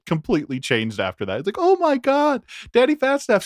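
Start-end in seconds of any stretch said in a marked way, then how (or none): 1.6-3.4 much higher, thrilled; starstruck fan. Retold or imitated. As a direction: squeal the name like a fan. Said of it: "he was, like, losing it".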